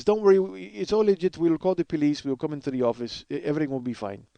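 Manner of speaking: reassuring Russian repo man; Russian accent. Acted. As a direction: do the Russian guy's voice. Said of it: "I love doing this Russian guy's voice".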